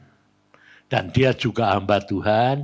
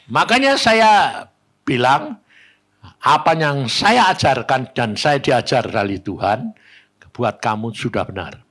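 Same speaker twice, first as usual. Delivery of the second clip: emphatic preaching; 5 dB louder than usual. Real, not acted. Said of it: "preaching emphatic point".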